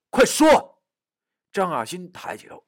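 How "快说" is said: high, loud, impatient command; anxious high-pitched yell; urgent shout. Acted. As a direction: demand an answer urgently. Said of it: "我着急地喊道".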